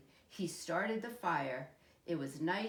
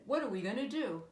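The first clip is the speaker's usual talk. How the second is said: mild mock alarm; 6 semitones higher, 5 dB louder, faster than usual. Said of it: "Dad said".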